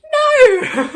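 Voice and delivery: high, loud anguished cry of no; a real wail of protest; outraged no. Real, not acted. Reacts to late release date.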